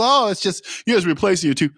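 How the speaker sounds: high-pitched mock dismissal; dismissive mimicry. Mimicking people who shrug off knocked-out teeth, dismissive.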